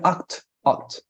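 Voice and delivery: "act" said as "aht", open vowel and final T; American ought. Shown as a demonstration. Teacher correcting the student's attempt, American ought. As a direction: model "act" as ah plus T, twice. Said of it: "the O and the T".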